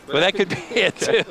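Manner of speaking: words broken up by real chuckles; amused, giggly agreement; laughing. Real, not acted. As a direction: laugh your way through the words. Laughing at own chicken joke.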